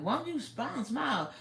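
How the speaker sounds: high coaxing nudge. Retold or imitated. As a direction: tease them into smiling. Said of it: "you gonna tell them".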